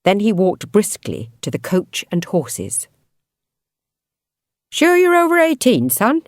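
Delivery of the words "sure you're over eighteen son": pub landlord voice. Acted. Landlord questioning young-looking Fox.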